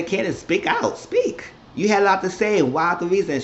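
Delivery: calling her out with a sharp rise on speak; hammed-up dare to speak up; mock challenge. Host calls out Candace in retelling.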